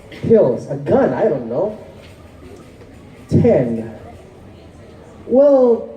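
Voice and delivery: measured, explanatory poetry recital; spoken-word poem. Acted. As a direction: recite it like a stage poem, stressing key words.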